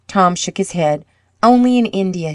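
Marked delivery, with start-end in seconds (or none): 1.4-2.3 understated sadness, pitched higher; sadly. A narrator's reading. Tom admits his only relatives are in India, sadly. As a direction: answer sadly in a raised little voice. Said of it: "he said sadly".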